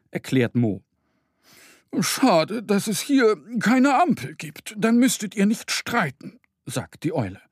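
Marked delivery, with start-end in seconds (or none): 2.0-6.4 put-on high, gentle old owl voice; wise owl. Owl regretting there is no traffic light, high voice.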